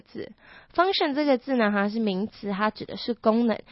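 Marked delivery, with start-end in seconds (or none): none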